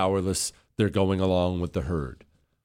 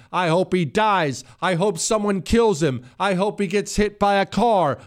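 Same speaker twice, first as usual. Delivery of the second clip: mock Democrat threats; 10 semitones higher than usual. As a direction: sneer it out as if imitating them. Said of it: "heard them speak like this".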